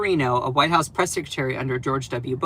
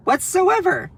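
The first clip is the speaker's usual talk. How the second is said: high, exasperated echo; incredulous; 13 semitones higher, 3 dB louder than usual. Real, not acted. Streamer mocking the critics, high and incredulous. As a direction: repeat it in disbelief. Mocking repeat of own phrase.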